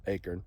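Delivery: "acorn" as AY-kern, low and soft; own regional pronunciation. Shown as a demonstration. Correcting himself to his own way, soft AY-kern for acorn. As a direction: drop "acorn" softly with a short "kern" ending. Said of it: "of course, I say acorn".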